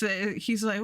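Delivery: ordinary talk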